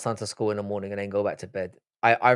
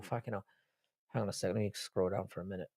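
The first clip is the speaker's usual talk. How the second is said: muttered much softer, half to himself; quiet aside; 4 semitones lower, 14 dB quieter, faster than usual. Real, not acted. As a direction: drop the voice away from the mic while scrolling. Muttering aside while scrolling chat.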